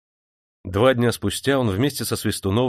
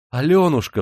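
higher, louder cry of her name; 6 semitones higher, 6 dB louder than usual. A narrator's reading. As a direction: call out to her with joy. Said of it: "вскричал Николенька". (радостный возглас)

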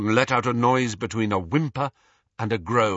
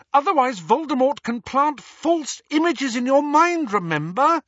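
much higher, louder, insistent; shrill lecturing; 4 dB louder than usual. A narrator's reading. Hermione scolding Harry about occlumency, high and shrill. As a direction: nag him urgently. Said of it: "said Hermione shrilly".

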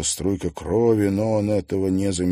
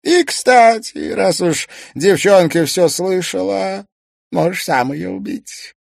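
sly villain offer; 11 semitones higher, 7 dB louder than usual. Acted. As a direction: dangle the offer slyly. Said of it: "предложил мастер игрушек".

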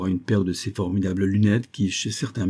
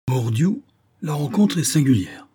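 raised-pitch amazed oath; stupefied; 6 semitones higher than usual. A narrator's reading. Captain stunned by the strange meeting, stupefied. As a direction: swear in amazement, pitch lifted. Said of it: "ajouta le capitaine Servadac stupéfait".

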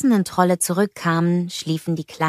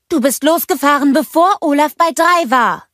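high, loud accusation; indignant protest; 9 semitones higher, 6 dB louder than usual. A narrator's reading. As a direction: shout a complaint after the cheat. Elsa protestiert lauthals beim Wettrennen. (empörter, lauter Vorwurf)

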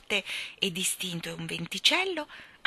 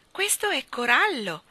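high, louder exclamation of wonder; admiring cry; 7 semitones higher, 6 dB louder than usual. A narrator's reading. Jane sees coral undersea, crying out admiringly. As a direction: call out in admiration. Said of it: "ella gridò ammirata".

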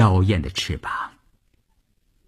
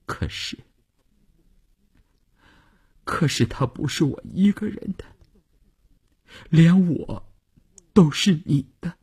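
high, tearful, wronged voice; small, hurt woman's voice on the edge of sobs; aggrieved sobbing; 10 semitones higher than usual. A narrator's reading. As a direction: plead through sniffles, pitched well up. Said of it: "乌云十分委屈地抽搭着".

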